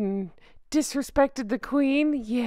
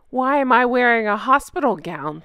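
usual speaking voice

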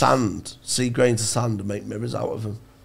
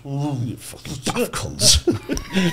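laughing mock insult; 3 semitones higher, much slower than usual. Mocking the tribe with laughter.

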